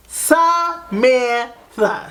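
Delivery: "Samantha" stretched syllable by syllable, high; drawn-out emphasis. Retold or imitated. Calling her friend after the discovery, "Samantha" in slow, separate syllables. Repeats friend's name in shock.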